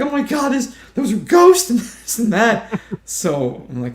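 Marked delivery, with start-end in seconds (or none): 0.0-2.8 high-pitched gushing excitement; mock overexcited, voice way up high; excited woman imitated. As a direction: squeal it like a thrilled believer. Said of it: "And she was so excited".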